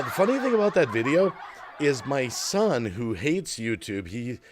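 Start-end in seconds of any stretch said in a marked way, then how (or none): 0.0-1.3 chuckling through the words; a real laugh bubbling up as the story starts; amused, half-laughing lead-in. Real, not acted.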